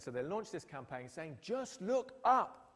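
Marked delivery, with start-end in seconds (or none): 1.4-2.7 slow, high and loud, each word stretched; drawn-out plea. Retold or imitated. Scientists launching a campaign to the public, slow loud plea. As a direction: plead it big and slow.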